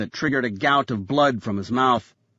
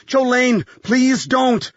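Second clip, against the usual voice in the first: high, louder pleading cry; urgent cry; 10 semitones higher, 4 dB louder, much slower than usual. A narrator's reading. Graham begs Jolene to stop, crying out urgently. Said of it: "Graham cried".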